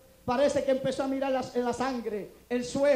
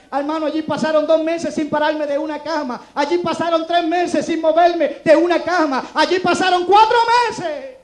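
each line louder than the one before; building preaching; 4 semitones higher, 10 dB louder than usual. Acted. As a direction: drive the count of months up to a peak. Predica subiendo la intensidad. (predicación que va creciendo)

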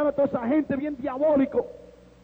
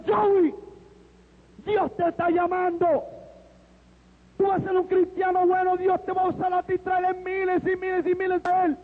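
higher, fervent proclamation; imitated preaching shout; 5 semitones higher than usual. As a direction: call it out like a zealous evangelist. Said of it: "empezó a gritar".

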